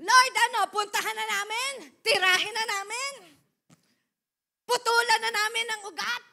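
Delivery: loud, high, eager "Tirahin na namin?"; eager servants. Acted. Servants eagerly ask the Lord to let them attack, loud eager. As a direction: burst out like servants itching to fight.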